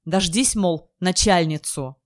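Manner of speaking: ordinary delivery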